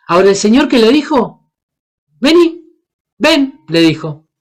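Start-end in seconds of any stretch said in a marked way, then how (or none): 2.2-3.6 higher inviting call; Jesus calling. Acted. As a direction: call Peter across the water. Jesús llama a Pedro. (llamado de Jesús, más agudo)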